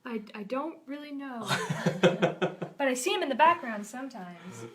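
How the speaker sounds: character line broken by laughter; amused. Acted. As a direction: deliver the halting answer through giggles. Acted quote, laughing at own joke.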